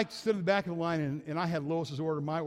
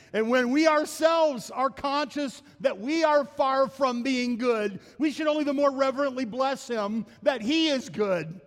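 raised, ringing preacher's voice, slowing on key words; fervent preaching; 7 semitones higher, 5 dB louder than usual. Acted. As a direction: proclaim it to the congregation. Preaching full force quoting Spurgeon.

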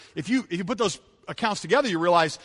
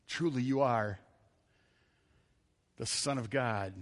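lower, softer hushed confession; reverent awe; 6 semitones lower, 6 dB quieter than usual. Retold or imitated. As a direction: speak it quietly in worship. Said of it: "they said when they fell down".

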